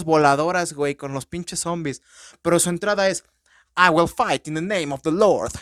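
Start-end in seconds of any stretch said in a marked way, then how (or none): 3.8-5.5 put-on priest's battle cry in an over-the-top English accent; super English accent. Priest charging zombies, hammed-up super English accent. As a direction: declare it like a proper English vicar going to war. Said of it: "con un acento súper, súper, súper inglés".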